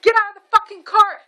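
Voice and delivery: high, loud furious mock order; retold screaming. Mom orders driver out, high mock scream. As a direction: yell it high as an enraged mom. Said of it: "I started screaming".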